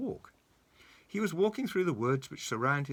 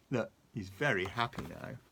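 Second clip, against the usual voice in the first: ordinary delivery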